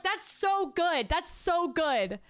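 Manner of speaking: high, slow gushing into a laugh; mock-delighted party guest cracking up; imitated gushing praise. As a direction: stretch out the praise, then burst out laughing.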